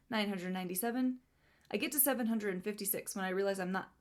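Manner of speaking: ordinary talk, nothing marked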